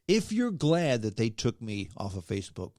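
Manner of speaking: ordinary talk, nothing marked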